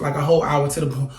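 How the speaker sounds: ordinary talk, nothing marked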